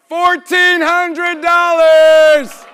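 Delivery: loud, high, slow drawn-out shout. Real, not acted. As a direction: belt the bid to the room. Shouting a big auction bid.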